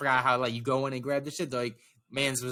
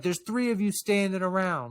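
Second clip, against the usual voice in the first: higher-pitched retold telling-off; imitated scolding; 6 semitones higher than usual. Lady tells three standing workers off, mildly imitated yelling. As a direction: scold idle staff, without full shouting. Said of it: "I yelled at them".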